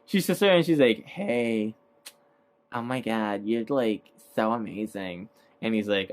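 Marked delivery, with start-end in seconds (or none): 1.1-5.3 soft, hushed imitation of a gushing fan; mock smitten admirer. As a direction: lightly voice a starstruck admirer, softly. Retold admirer flirting with Steve.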